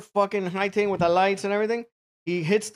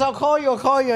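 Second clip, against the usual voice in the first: raised pitch, hurried placating promise; imitated woman; 7 semitones higher than usual. Woman placating the driver, high imitated voice. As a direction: mimic her quick reassurance, pitched up. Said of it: "And she goes".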